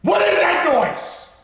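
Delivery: very high, drawn-out scream; screamed outrage. Real, not acted. Host at peak rant over film music, screaming in exasperation. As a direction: scream the question slowly at full force.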